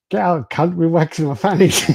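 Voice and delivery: high, loud retold shout; mock indignant yell. Household members caught waxing shout at the intruder, mock indignant yell.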